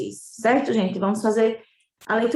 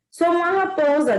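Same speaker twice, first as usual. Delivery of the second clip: higher fox voice; 6 semitones higher, 4 dB louder than usual. Acted. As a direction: present yourself as the fox. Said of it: "disse a raposa". (voz aguda da raposa)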